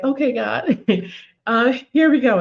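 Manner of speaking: a rueful laugh through the words; laughing resignation. Retold or imitated. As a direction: laugh it off like here we go again. Rear-ended, laughing at God.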